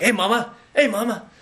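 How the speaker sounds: high, leering mock catcall; a high, sleazy catcall; Clubber Lang flirting. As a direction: catcall her like a cocky boxer. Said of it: "He was like".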